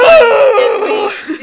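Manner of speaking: loud, high cry to be heard over him; shout. Real, not acted. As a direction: yell it over the other voice.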